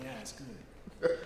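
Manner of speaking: slow whispered polite agreement; polite kids' reply. Retold or imitated. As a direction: whisper it slowly, just being polite. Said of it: "And we'd be like".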